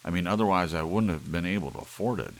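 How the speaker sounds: loud, irritated retort; scowling. Acted. Larry to Doris, scowling and irritated. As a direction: snap it out defensively. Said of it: "he scowled".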